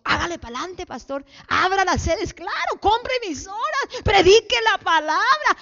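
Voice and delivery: high-pitched, excited urging; hammed-up cheering on. Imagined congregation egging the pastor on, hammed-up excitement. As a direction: urge him on in a mock-enthusiastic high voice.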